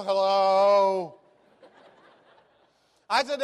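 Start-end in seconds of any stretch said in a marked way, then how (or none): 0.0-1.2 loud, long drawn-out hello; caller's awkward hello. Retold or imitated. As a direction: imitate a put-on-the-spot caller, stretching the word. Imitates trapped caller's reluctant greeting.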